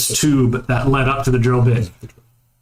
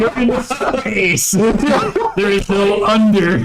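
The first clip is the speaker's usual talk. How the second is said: laughing; 8 semitones higher, slower than usual. Real, not acted. GM laughs off Underminer joke.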